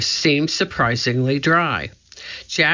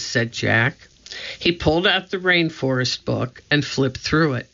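plain reading voice

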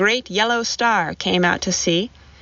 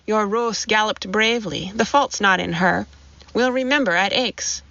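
ordinary delivery

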